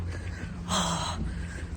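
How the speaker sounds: long breathy exhale; heavy sigh. Real, not acted. Woman walking and vlogging, heavy sigh. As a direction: let out a long, voiceless sigh.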